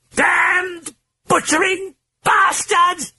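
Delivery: very high, slow shrieking British voice; hoarse British shriek. A narrator's reading. The British voice shrieking at enemies. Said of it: "shrieked in the hoarse British accented voice".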